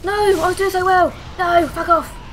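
loud protest at losing; frustrated outcry. Real, not acted. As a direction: cry out in frustration at dying. Dies in game, protesting.

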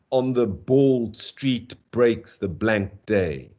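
slow recital with heavy beats on "bald street breaks"; deliberate, weighty stresses on "bald street breaks" and "blank day"; stressed syllables. Shown as a demonstration. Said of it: "then three stressed syllables".

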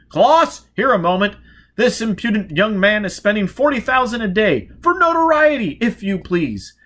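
high, loud bluster; boisterous old gentleman. Acted. Charles summoning Klaus to meet the upstart, loud. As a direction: bark it out with gleeful gusto.

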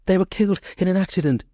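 high, fast, breathless fright; frightened nurse, pitched high and rushed; gasped out. Acted. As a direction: blurt it out in terror. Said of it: "she gasped".

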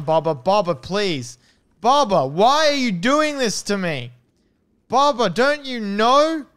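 loud, high, hammed-up begging that keeps rising; mock pleading wail. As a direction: wail at Baba like a betrayed friend, overdone. Pleading with a game character.

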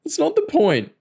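high, tearful whine; weeping crook refusing to be consoled; sniffling. A narrator's reading. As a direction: sniff through the protest. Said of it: "Joe sniffled".

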